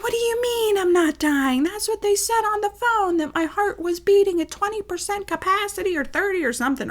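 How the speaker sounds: very high-pitched mother impression; exaggerated high voice, outraged disbelief; indignant mother. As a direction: protest shrilly as the affronted mother. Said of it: "And she's like".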